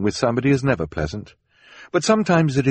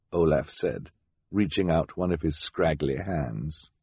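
usual speaking voice

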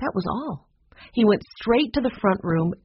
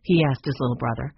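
ordinary delivery, nothing marked

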